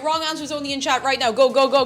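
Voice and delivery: ordinary talk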